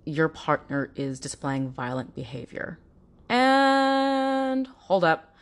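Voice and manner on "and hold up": drawn-out, high, louder hold up; dramatic hold up. Real, not acted. Host stopping her own lecture, drawn-out and emphatic. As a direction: slam the brakes on the point.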